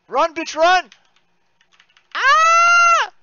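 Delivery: very high, loud shout into a scream; high-pitched urgent shouting; frantic yell. Real, not acted. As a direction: urge them on, then scream. Urgent yelling in game.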